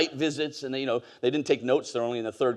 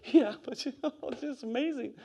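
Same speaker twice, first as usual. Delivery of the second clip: put-on high, soft child's voice, laughing through it; higher, softer little-girl voice with a laugh; little daughter's voice; 10 semitones higher, 6 dB quieter than usual. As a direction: play a small girl who is amazed. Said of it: "She's like".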